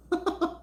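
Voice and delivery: short, high burst of laughter; a quick amused giggle; bright little laugh. Real, not acted. Laughs at viewers' comments.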